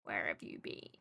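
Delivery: quiet teasing repeat; hushed mock question. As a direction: drop it to a murmur. Repeats question, then whispers it.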